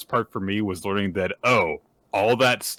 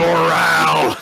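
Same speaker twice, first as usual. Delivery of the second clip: mock Creed voice; 8 semitones higher, 10 dB louder, slower than usual. Co-host finishing Creed's line.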